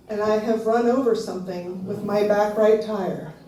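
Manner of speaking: ordinary delivery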